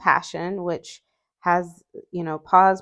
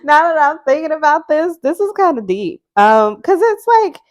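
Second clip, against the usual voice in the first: high and loud, still laughing through the words; 11 semitones higher, 11 dB louder than usual. Real, not acted. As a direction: giggle through the realisation. Laughing realisation card is deep.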